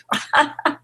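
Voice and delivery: breathy high laugh; a quick delighted giggle; unvoiced little laugh; airy giggle. Real, not acted. Laughs at sleepy spirit daughter.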